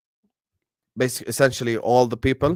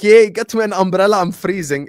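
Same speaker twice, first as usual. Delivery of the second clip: higher, louder put-on cheer, easing off; mock cheer; 7 semitones higher, 5 dB louder than usual. Streamer mimicking someone caught in cold rain, mock cheer. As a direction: play a gleeful complainer, pitched up.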